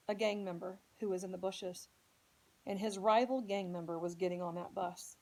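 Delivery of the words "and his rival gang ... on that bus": ordinary delivery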